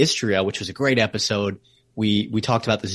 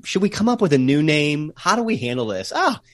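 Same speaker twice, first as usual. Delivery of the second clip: higher-pitched, lightly acted puzzling it out; imagined city planners; 6 semitones higher than usual. Guest imagining founders choosing a city name, lightly acted deliberation. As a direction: lightly voice a committee debating, then landing on an idea. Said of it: "were they thinking".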